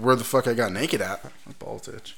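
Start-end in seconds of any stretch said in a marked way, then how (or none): 1.5-2.2 barely voiced, very soft; near-whispered throwaway remark; muttered aside. Real, not acted. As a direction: mutter it off to the side. Throwaway aside before changing topic.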